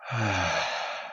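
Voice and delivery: long, breathy exhale of relief; sigh of relief. Shown as a demonstration. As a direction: let out a comfortable, drawn-out sigh. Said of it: "comfortable sigh of relief".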